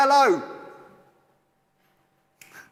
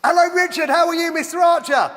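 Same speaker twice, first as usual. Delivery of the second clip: high, loud, hearty greeting; big raised-voice hello; calling out; 5 semitones higher, 5 dB louder than usual. Real, not acted. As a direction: hail him loudly over a bad connection. Calling out to guest after connection freeze.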